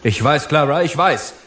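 calling back. A narrator's reading. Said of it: "rief er ihr entgegen".

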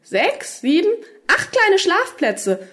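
high, slowed counting in delighted wonder; enthusiastic. A narrator's reading. Rika counts tiny sleeping places, enthusiastic. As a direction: count up with mounting excitement. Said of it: "rief sie begeistert".

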